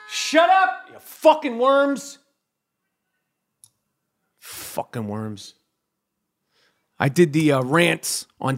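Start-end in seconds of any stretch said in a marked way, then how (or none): 0.1-2.2 sudden high-pitched yell at the neighbors; mock yell. Yelling at the stomping neighbors upstairs, high mock yell. 4.4-5.5 very soft, slow, low "Fucking worms"; muttered insult. Real, not acted. Muttering about the neighbors, soft and slow.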